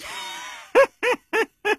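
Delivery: high, acted chuckling laugh. Sangkun laughing after his boast, acted laugh. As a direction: laugh in separate, high bursts.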